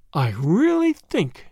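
slow, drawn-out musing; slowly. Acted. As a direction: take your time over each word. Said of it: "said Grandma slowly".

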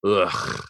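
low grunt of disgust; disgusted ugh. Retold or imitated. Dad imitating his daughter's disgust, a low grunt. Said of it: "I hear my daughter go".

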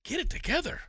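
high, hushed scolding; Christy. Retold or imitated. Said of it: "That's when Christy goes".

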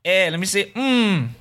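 flirty uncle voice. Retold or imitated. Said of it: "my friend's uncle's".

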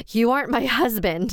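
backing away in horror; terrified realization. Acted. Terrified realization after gasp.

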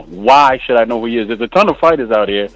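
ordinary talk

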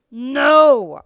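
loud, high roared refusal. A narrator's reading. Drill master to struggling recruit, roaring. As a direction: bellow it at the recruit. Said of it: "Ost roared".

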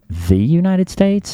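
querying THE United States, drawn out and rising high; slow, high-rising question on "The United States?"; incredulous correction. Retold or imitated. Correcting the girlfriend who dropped the article, incredulous question on "The United States?".

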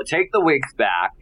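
ordinary talk

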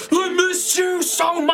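high, slow crying voice; tearful cry. Acted. Friend bear-hugging Nova, high slow tearful cry. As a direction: sob it out, overjoyed. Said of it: "He's like".

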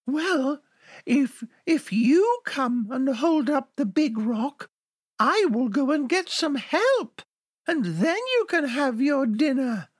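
high, pleading lamb voice; little lamb tricking the wolf. Acted. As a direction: beg sweetly in a tiny high voice.

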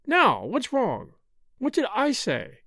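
high, slowed, puzzled wife; bewildered demand. A narrator's reading. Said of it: "demanded Althea, bewildered".

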